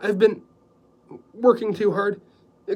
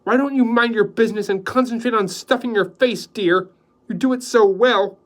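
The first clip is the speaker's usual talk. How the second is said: snide; 9 semitones higher, 9 dB louder than usual. Acted. Joy snipes at Hixie.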